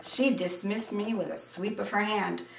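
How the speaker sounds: ordinary delivery, nothing marked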